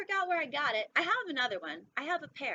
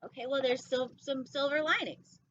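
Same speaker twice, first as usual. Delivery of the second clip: usual speaking voice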